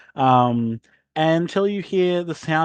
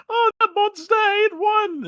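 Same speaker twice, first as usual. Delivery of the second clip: very high, slow, stretched lament; wailing duck; slower than usual. Acted. Mildred wailing to the landlord, very high and slow. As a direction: moan it like a tragedy.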